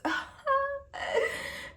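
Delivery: squealing high-pitched laugh; a sudden shrill-edged giggle. Real, not acted.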